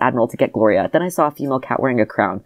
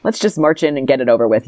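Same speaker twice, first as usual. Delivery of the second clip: plain reading voice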